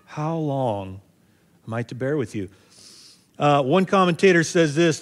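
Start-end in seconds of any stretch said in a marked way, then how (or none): 0.0-2.5 softer, tired, worn-out sigh of a question; a hint of exasperated weariness; weary frustration. Shown as a demonstration. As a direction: let it sink as if worn down. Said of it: "I don't know his tone, but he's like".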